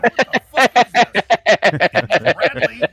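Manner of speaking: can't hold it together while reading; laughing through it. Real, not acted. Host rereading the line and cracking up with friends.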